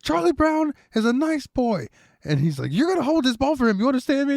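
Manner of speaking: a squeaky mock tough guy laying down the law; Peter voice. Retelling Peter's threat to Lucy, in a hammed-up high voice. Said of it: "And is like".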